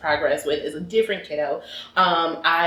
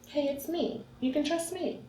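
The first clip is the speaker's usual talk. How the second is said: softer, higher, gently reassuring; gentle reassurance; 10 semitones higher, 9 dB quieter than usual. Retold or imitated. Therapist reassuring the scared boy, soft and gentle. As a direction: soothe a frightened child softly. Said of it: "And I said".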